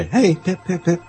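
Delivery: high-pitched call to a dog. Acted. Owner getting the dog's attention, high playful call. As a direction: call the pup over in quick high repeats. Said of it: "attract the dog's attention and go".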